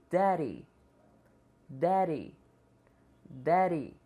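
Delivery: daddy with the dd as a quick tap, like derry; flapped d. Shown as a demonstration. Teacher models daddy with flapped d. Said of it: "três vezes cada uma".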